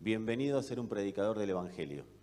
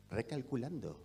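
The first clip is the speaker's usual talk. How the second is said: GPS voice; 6 dB quieter than usual. Retold or imitated. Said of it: "¿Y qué dice?".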